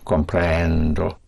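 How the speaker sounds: comprendo slow, pressing "pren" and surfacing on the final O; stress on pren. Shown as a demonstration. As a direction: push down on "pren", then let the O come up. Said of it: "Push down, comprend, and you surface on O".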